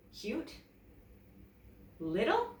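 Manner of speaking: incredulous rising questions; indignant echo. A narrator's reading. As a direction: repeat his words, offended. Emily offended by Dad's words.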